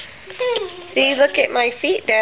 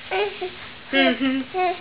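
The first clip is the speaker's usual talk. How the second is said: usual speaking voice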